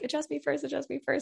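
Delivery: put-on high, pleading child's voice repeating the plea; begging like an impatient little kid at the chiropractor; eager little kid. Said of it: "they were like".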